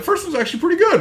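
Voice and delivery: squeaky high mock praise; mock enthusiasm. Podcaster mocking fans of the first movie, high and squeaky. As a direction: parody the fans who liked it. Mocking what other people say.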